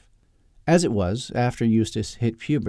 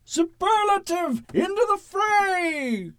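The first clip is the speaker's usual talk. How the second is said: very high, long-drawn heroic call; superhero battle cry; slower than usual. Acted. Costumed hero charges into the scuffle, high drawn-out battle cry.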